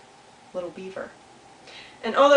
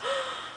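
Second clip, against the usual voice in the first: high, soft storybook gasp; quick acted intake of breath; 7 dB quieter than usual. Beaver spots somebody new.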